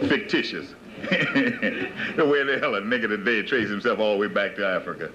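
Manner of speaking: low, chuckling scoff; real chuckle running into the words; scornful laughing. Real, not acted. Scoffing at claims of traced roots.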